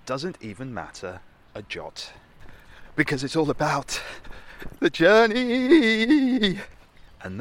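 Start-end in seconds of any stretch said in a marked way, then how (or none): 3.0-6.6 high, loud, stretched-out epic narrator; epic trailer voice. Pretend. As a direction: swell up to a grand finale. Mock grand trailer presenter.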